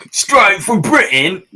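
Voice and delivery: loud, high, slow mock British; British accent. Painter boasts of his fake accent, loud mock British. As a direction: proclaim it in exaggerated Cockney British. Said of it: "a good British accent".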